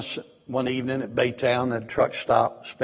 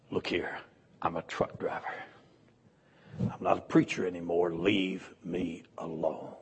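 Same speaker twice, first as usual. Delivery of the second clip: weary whisper; 5 semitones lower, 5 dB quieter than usual. Retold or imitated. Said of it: "I said".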